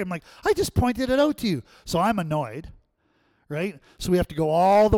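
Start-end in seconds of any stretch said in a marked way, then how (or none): 0.4-1.6 high and loud reproach; loud, high complaint; exasperated. Retold or imitated. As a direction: snap back at him, fed up. Angry at driver.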